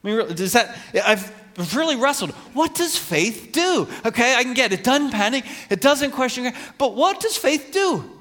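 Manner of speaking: high, loud, urgent questioning; exasperated wrestling. Real, not acted. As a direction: wrestle aloud, demanding an answer.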